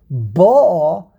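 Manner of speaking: BO'a, long loud first syllable; stress on first syllable. Shown as a demonstration. Teacher demonstrating the past tense, first syllable stressed in BO'a. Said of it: "put the emphasis on the first syllable".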